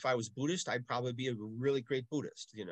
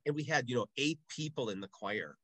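ordinary talk, nothing marked

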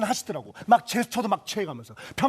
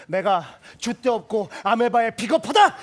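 higher and louder, building with a laugh; worked-up rant; 5 semitones higher, 5 dB louder than usual. Acted. He rants about showing his friend, higher, louder, laughing. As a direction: work yourself into a frenzy.

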